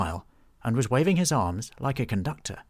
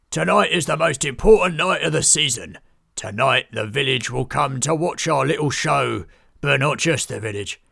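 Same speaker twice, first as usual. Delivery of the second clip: booming ringmaster; 4 semitones higher, 7 dB louder than usual. Acted. Ringmaster summoning all performers, booming loud. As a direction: boom it across the whole camp.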